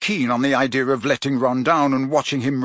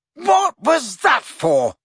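a furious, much higher shout; bellowing; 11 semitones higher, 4 dB louder, much slower than usual. A narrator's reading. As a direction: bellow it out, upside down and outraged. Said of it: "Harry bellowed".

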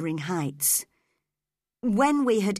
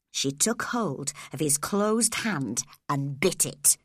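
ordinary delivery

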